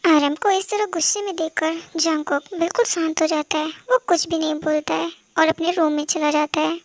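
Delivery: calmly telling the story; story narration. A narrator's reading. कहानी का वाचन. (धीमी आवाज़ में कहानी सुनाना)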